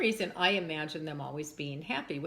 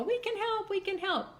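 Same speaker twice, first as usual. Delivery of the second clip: high-pitched imitation of chiming-in children; eager kids; 13 semitones higher than usual. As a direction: pipe up like keen little kids. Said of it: "the kids go".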